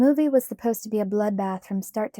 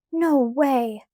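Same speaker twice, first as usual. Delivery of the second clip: excited shout; 4 semitones higher, 6 dB louder than usual. A narrator's reading. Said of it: "I shouted".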